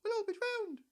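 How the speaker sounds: a tiny mouse squealing in fear; squeaky mouse. A narrator's reading. Mouse panicking at the flood, squeaking high. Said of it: "squeaked the mouse".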